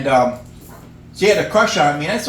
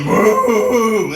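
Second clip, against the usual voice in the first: louder lusty moo; horny cow; 4 semitones higher, 6 dB louder than usual. Retold or imitated. Comic showing how a horny cow moos, louder. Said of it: "when they're horny".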